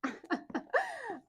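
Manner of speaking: high breathy giggle; delighted high giggle. Real, not acted. Laugh after free books.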